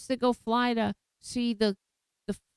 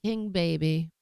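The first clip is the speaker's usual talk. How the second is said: ordinary talk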